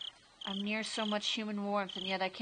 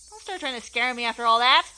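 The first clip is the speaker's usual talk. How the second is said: loud protest; 2 semitones higher, 10 dB louder than usual. Acted. Keiichi protesting.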